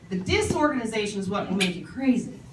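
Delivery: usual speaking voice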